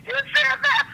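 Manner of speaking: high, loud disbelieving laugh-question; surprised. Real, not acted. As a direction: gasp it out in delighted disbelief. Daughter hears father's bold retort.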